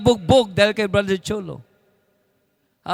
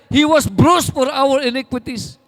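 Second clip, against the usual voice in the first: loud, higher, slow shouted scripture; proclaimed verse; 5 semitones higher, 7 dB louder than usual. Acted. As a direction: cry the verse out to the church. Quotes scripture. (sumisigaw na pagpapahayag ng talata)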